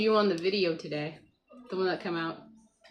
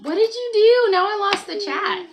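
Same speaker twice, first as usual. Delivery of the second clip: real, raised-voice exasperation; dismayed outcry; 11 semitones higher, 9 dB louder than usual. Real, not acted. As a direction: exclaim in alarm as the chat vanishes. Child hit keys, chat lost.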